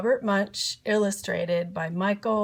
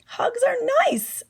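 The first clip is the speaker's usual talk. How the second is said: high sweet voice; 14 semitones higher than usual. Acted. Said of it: "said his mom".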